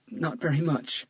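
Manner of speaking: tight, strained reply; strangled voice. Acted. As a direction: squeeze the words out. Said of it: "Tanya replied in a strangled voice".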